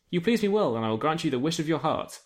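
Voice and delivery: ordinary talk, nothing marked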